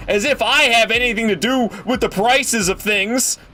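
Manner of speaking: angry rant. Real, not acted. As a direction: fire it back like a fed-up cashier. Ranting at yelling customers.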